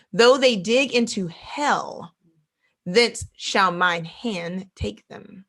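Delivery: slow, weighty verse reading; preacher slowly reading a warning verse; solemn scripture reading. Acted. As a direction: read each phrase slowly, letting it land.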